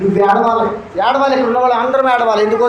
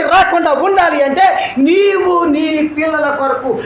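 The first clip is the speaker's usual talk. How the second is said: raised, rhythmic list of reproaches; urgent preaching; 6 semitones higher than usual. Acted. Preacher warning congregation their families are perishing, urgent preaching. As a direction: drive each charge home in a repeating cadence.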